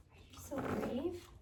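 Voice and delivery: gasp, then a high burst of laughter; delighted, breaking into a laugh; laughing praise. Real, not acted. Trainer laughing with delight at the brave dog.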